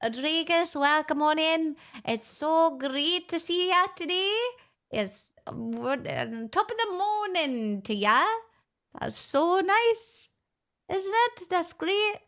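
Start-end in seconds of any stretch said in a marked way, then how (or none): 0.0-4.4 higher, cheery mock Irish greeting; playful Irish accent; fake Irish accent. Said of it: "this incredible accent that everybody loves". 6.5-12.2 higher, hammed-up Irish lilt; mock Irish accent, bright and teasing; fake Irish accent. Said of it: "this incredible accent".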